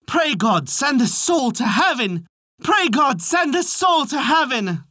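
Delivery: high, slow chanted call; drawn-out high refrain, climbing on the repeat; ringing cry. A narrator's reading. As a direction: let the cry ring out in singsong. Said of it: "his cry rang out".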